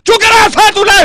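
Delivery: put-on sharp, loud, high scold at a kid; angry scolding. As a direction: tell the boy off hard.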